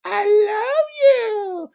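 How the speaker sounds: very high, slow crying falsetto; high mocking sob voice; mock whiny love. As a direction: wail it in a squeaky fake sob. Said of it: "again the song line".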